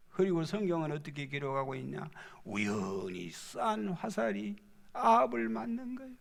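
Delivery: ordinary talk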